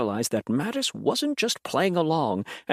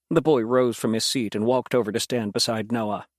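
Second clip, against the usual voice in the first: ordinary delivery, nothing marked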